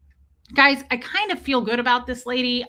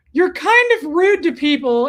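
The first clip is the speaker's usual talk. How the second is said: scolding cat; 5 semitones higher, 8 dB louder than usual. Pretend. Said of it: "owner scolds the cat".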